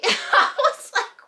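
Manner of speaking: high, loud laugh through the words. Real, not acted. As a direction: laugh in disbelief. Laughs at the insult.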